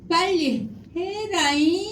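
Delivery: high, loud, drawn-out nagging; 잘못된 단호함. Shown as a demonstration. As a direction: whine the order high and stretch it. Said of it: "조금 목소리가 낮은 게 좋아요". (높고 길게 늘인 재촉)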